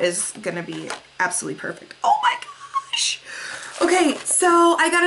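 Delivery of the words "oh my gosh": very high squeal with a laugh; real delighted squeal, slowly. Real, not acted. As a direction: shriek with joy, laughing.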